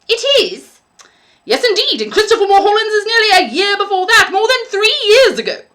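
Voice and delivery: put-on high, sugary old lady voice, softening at the end; a doting landlady, gushing then hushed; sweet old landlady. A narrator's reading. Landlady fawning over young Billy, sweet high voice.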